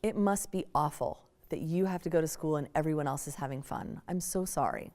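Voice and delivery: soft, low, tender sympathy; gentle sympathy. Retold or imitated. Mother comforting her upset teenage daughter, soft tender sympathy. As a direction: speak softly as if comforting a hurt child. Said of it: "she said".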